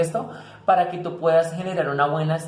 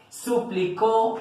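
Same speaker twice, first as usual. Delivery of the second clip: "suplicó" drawn out slowly, higher in pitch; stress in suplicó; 6 semitones higher, much slower than usual. Shown as a demonstration. Teacher shows students where the tilde goes, slow stressed example. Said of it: "tilde en la U también".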